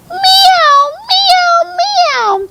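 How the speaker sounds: very high, loud meows; cat's meow. A narrator's reading. Dog making the cat's noise, high loud meowing. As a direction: mew it three times, high and piercing. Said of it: "said the dog".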